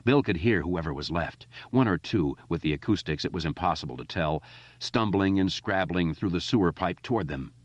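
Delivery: ordinary delivery, nothing marked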